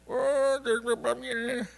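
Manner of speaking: high, slow, self-pitying whine; whiny Calimero. Pretend. Preacher mimicking Calimero to the church, whiny and self-pitying. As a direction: moan like a sulky cartoon chick. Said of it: "un Calimero".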